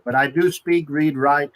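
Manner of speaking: normal talking voice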